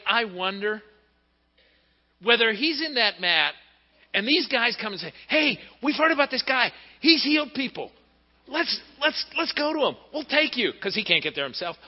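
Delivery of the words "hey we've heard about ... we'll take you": high, eager friends coaxing him along; lightly voiced eager friends. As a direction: coax him like excited buddies. Friends urging the paralyzed man.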